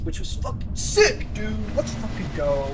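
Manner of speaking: loud, high burst of excitement; excited bragging. Real, not acted. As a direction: blurt it out thrilled, punching the last word. Excited about a test score.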